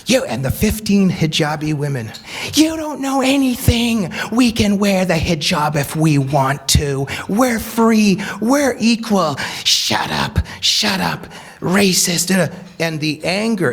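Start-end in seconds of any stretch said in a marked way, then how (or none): 0.0-9.4 higher, mocking quote of angry protesters; mock shouting protesters. Quoting the hijabi women screaming at the preacher, mocking. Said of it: "they're screaming at him and at me". 9.6-12.5 mock yell. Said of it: "they're screaming at him and at me".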